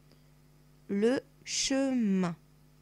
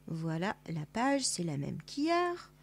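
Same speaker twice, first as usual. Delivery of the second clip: ordinary delivery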